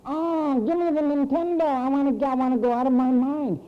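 lightly voiced Nintendo-mad child; mock eager kid. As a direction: ham up a greedy kid. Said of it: "Wildly and enthusiastic".

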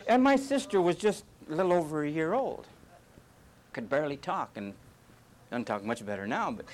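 ordinary talk

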